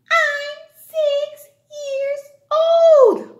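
put-on high, slow child yell; shouting child voice. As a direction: yell it like an eager kid.

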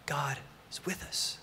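slow, whispered reassurance; very soft, drawn-out comfort; hushed assurance. Real, not acted. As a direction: let it sink to a slow whisper. Preacher drops to hushed reassurance.

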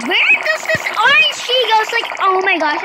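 fast, high frustrated outburst; frantic exasperation. Real, not acted. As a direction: blurt it out in frustrated panic. Frustrated at the orange key.

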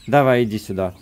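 ordinary talk

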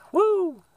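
quick, very high "woo!"; high whoop. Real, not acted. Vlogger cheering morning yoga on the beach, high whoop. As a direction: let out a high-pitched whoop.

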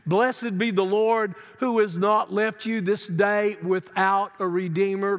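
ordinary delivery

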